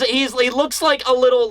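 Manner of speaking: normal talking voice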